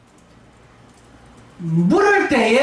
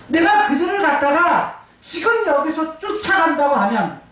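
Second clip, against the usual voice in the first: ordinary delivery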